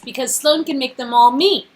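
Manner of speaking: usual speaking voice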